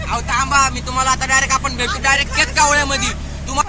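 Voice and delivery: loud, high calls to hear the echo; shouting in the tunnel. Real, not acted. Vlogger shouting to viewers inside a tunnel, loud and high. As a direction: yell it out so it booms off the tunnel walls. Still calling out loudly.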